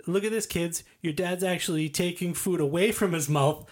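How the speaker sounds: high mocking carny heckle; hammed-up jester taunt; heckling jester. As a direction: roast him like a jester on a stage. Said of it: "he goes".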